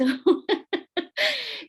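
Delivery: real high, loud burst of laughter; laughing. Real, not acted. Speaker amused at the tomatoes difference, laughing. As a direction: laugh out loud, pitched well up.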